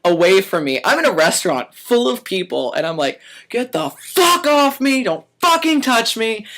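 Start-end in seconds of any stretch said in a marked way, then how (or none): 3.5-6.5 slow, much higher angry yell; retold cussing out. Waiter cussing out the boss in a full restaurant, slow and high. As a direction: scream it across the restaurant. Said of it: "I'm cussing him up one side".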